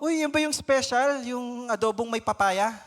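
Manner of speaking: high, loud bright excitement over the dish; cheerful eagerness. Acted. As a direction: sound delighted about dinner. Cheerful parent asks about dinner. (masiglang pagkasabik)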